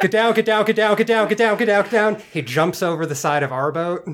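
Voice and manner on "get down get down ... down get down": panicked under fire. Acted. Warning others before explosion.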